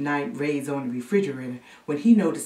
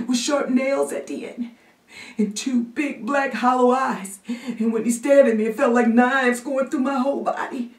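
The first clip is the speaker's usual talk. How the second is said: put-on high, gasping frightened child; trembling kid reliving a fright; shaking, scared boy; 8 semitones higher, 5 dB louder than usual. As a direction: catch your breath between phrases, building in panic. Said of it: "who was shaking like new jello".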